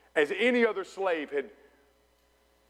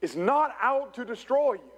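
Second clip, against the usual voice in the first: raised-pitch sermon delivery, punching key words; emphatic preaching; 6 semitones higher than usual. Real, not acted. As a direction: preach it with rising conviction. Preaches to congregation.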